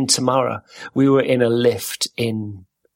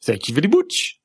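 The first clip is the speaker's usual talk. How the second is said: goofy put-on silly voice; 3 semitones higher than usual. Shown as a demonstration. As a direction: thank the cashier in a silly voice. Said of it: "in a silly voice".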